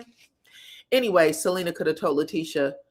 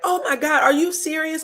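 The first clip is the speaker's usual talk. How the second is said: high-pitched imitated disbelief; mock shock; 8 semitones higher than usual. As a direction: act out the whole table's astonishment. Voicing everybody's shocked reaction.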